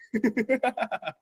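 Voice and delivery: high, soft laugh; trailing giggle after an outburst; giggling. Real, not acted. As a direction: laugh it out quietly, high-pitched. Laughing after the joyful rant.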